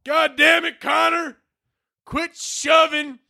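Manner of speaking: high, strained Southern dad holler; yelling dad. Acted. Dad starts yelling at his son, angry Southern holler. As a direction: yell it slowly at a misbehaving son. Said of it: "his dad starts yelling at him".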